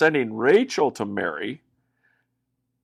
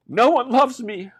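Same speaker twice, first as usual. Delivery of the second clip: sad; 10 semitones higher than usual. Acted. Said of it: "Leah said".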